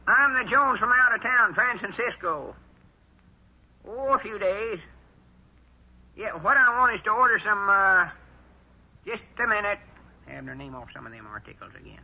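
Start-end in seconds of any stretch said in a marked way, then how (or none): 0.1-9.8 pitched well up to fool the caller; old man faking a high voice on the phone; high disguise voice. Acted. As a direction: put on a high voice to disguise yourself. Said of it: "I'll use my high voice".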